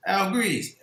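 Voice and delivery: retold firm exclamation of recognition; firm call. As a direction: call out the name firmly, as if recognizing someone. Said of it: "The way he said it so firm".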